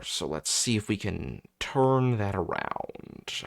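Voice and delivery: ordinary talk, nothing marked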